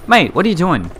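loud, high exasperated call; exasperated protest. Real, not acted. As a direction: protest to a clueless teammate. Protests at game teammate.

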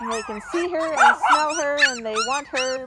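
speaking up loudly over the yapping pups. Real, not acted. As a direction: project over the puppy noise. Calling over the puppies' noise.

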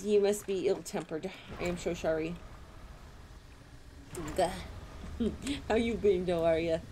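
ordinary talk, nothing marked

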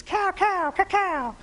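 high nasal-free quack; mama duck call. Retold or imitated. Preacher imitating the mama duck calling her ducklings. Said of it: "making the duck noises".

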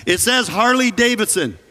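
high, hollered answer to someone upstairs; yelling back. Retold or imitated. Husband reads his shirt out to wife upstairs, yelling back. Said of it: "And I yelled back".

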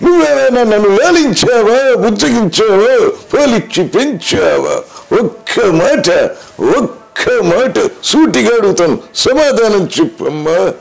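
high, slow, grand dramatic film voice; Karna questioning mother. Acted. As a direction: demand an answer like Karna on screen, high and drawn out. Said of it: "కర్ణుడిగా తన తల్లిని ప్రశ్నించే dialogue". (కర్ణుడిలా నెమ్మదిగా, ఎత్తైన గొంతుతో నాటకీయంగా)

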